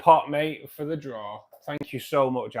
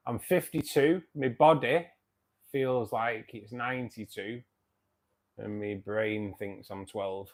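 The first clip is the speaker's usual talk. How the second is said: ordinary talk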